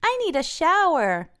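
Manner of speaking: high whiny plea; Francine pleading. A narrator's reading. Francine pleading after the mud, high whine. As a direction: beg for a shower, pitched high. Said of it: "Francine cries".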